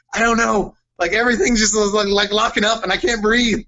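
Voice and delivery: panicky plea. Retold or imitated. Retold panic attack plea.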